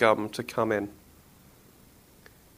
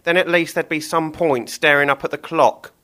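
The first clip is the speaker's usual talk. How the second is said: higher, louder dim butcher's voice; Billy the butcher; 5 semitones higher, 5 dB louder than usual. Acted. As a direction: play the thick butcher musing. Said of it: "Billy thought".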